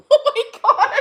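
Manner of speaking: loud disbelieving laugh on the words. Real, not acted. Laughing at absurd claim.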